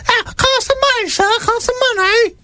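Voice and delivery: very high begging urchin squeak; street urchin. Acted. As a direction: cadge coins in a tiny child's voice. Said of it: "That's how they sound".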